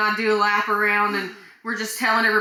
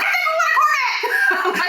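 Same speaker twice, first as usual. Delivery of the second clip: very high, loud, slow, laughing shout; bursting excitement; 7 dB louder, slower than usual. Retold or imitated. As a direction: let the joy burst out. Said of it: "I wanna be like".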